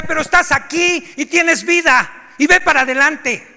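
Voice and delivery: group leader scolding a crying newcomer; stern scolding. Retold or imitated. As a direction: tell him off, no sympathy. Leader pushes him on.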